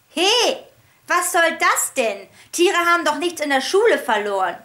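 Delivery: put-on loud rude boy heckling. A narrator's reading. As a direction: jeer from the back bench. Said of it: "tönte es da".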